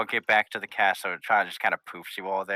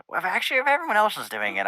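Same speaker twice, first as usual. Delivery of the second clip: much higher, quicker grudging pony voice; Rainbow Dash voice; 10 semitones higher, faster than usual. Acted. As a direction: rush it, pitched up and reluctant. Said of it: "She goes".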